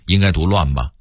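ordinary talk, nothing marked

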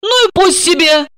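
high, careless dismissal; high-pitched, offhand and amused; smirking. A narrator's reading. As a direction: shrug it off with a smirk. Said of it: "усмехнулась Марина".

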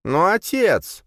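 put-on high, drawn-out childish plea; whiny protest. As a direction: whine like a spoiled son. Son protests to his father.

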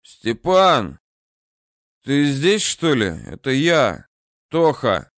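чуть выше и слабее обычного; слабо зовёт, изображая немощного старика; слабый голос повыше. Acted. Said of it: "Голосом выше своего обычного он слабо позвал". (слабый голос старого бомжа)

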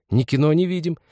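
put-on high, hurt girlish complaint; plaintive and put out; aggrieved girl. A narrator's reading. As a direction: grumble in an offended girl's voice. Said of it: "продолжал уже обиженно второй голос".

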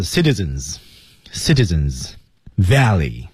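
teacher's slow articulation drill; careful pronunciation. Shown as a demonstration. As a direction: model each word slowly for students. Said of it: "注意上齿要下唇内侧".

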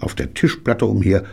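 ordinary delivery

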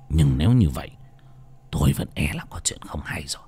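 low, hushed, sinking into a whisper; voice drops low. Acted. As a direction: let the voice fall grave and secret. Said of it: "thầy Kha lại trầm xuống".

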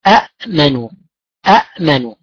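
أأمنوا said with two separate glottal stops, a-'-manu; original form with two hamzas. Shown as a demonstration. Teacher demonstrating the original form to students, hamza stops clearly separated. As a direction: pronounce أأمنوا with a clear second hamza stop before mim. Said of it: "أصل كلمة آمنوا".

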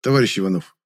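ordinary delivery

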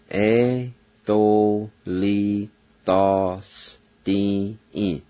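E to li ta s ti i drawn out very slowly, x0.5; slow blending. Shown as a demonstration. Teacher slowly blending the word for learners. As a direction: stretch E to li ta s ti i out slowly.

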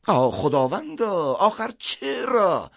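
high, slow, drawn-out lament; anguished appeal. Acted. Priest accused of treachery appeals to God, lamenting. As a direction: cry to heaven, stretching the words high.

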